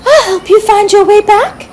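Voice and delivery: high, smiling fairy reassurance; comforting fairy. A narrator's reading. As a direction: smile through it to calm the squirrel. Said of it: "she said with a comforting smile".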